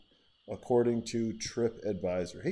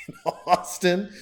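laughing; 9 semitones higher, 6 dB louder, slower than usual. Real, not acted. Laughing through city list.

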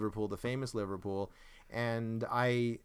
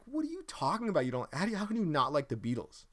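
incredulous disbelief; 6 semitones higher than usual. Retold or imitated. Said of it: "And I was like".

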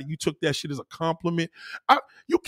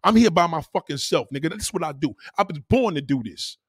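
normal talking voice

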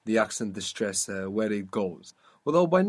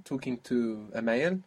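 normal talking voice

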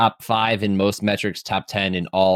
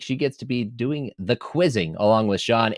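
normal talking voice

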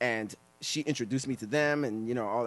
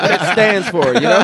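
laughing; 6 semitones higher, 15 dB louder, slower than usual. Real, not acted. Laughing while making a point.

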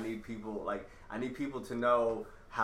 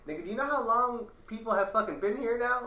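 indignant high retort; 8 semitones higher than usual. Retold or imitated.

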